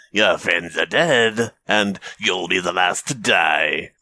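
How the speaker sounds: slow, eerie drawn-out voice; hallucinated voice. A narrator's reading. Hallucinated voice warning the runner of death, eerie. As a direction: intone it slowly as a voice in his head. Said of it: "I kept hearing the voice saying".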